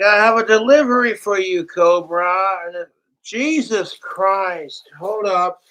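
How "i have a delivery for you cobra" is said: higher, louder, quick chirpy imitation; imitating the delivery lady. Retelling the delivery lady at his door, he mimics her quick, higher voice. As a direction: parrot her perky doorstep greeting, fast.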